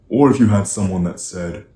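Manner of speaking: usual speaking voice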